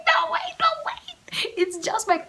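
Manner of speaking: very high flustered protest; squeaky panicked denial. Retold or imitated. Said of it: "I was like".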